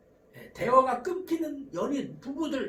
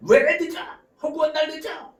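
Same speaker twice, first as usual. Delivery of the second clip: loud, high accusing shout; acted attack; 7 semitones higher, 9 dB louder than usual. Spouse confronting a late partner, loudly aggressive. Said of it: "이렇게 공격적인 언어가 오가는 거죠".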